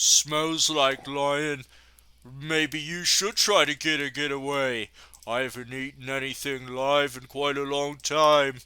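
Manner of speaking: hungry brute looming over his prey; Boris. Acted. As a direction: play a menacing, hungry oaf. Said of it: "Boris approaches you".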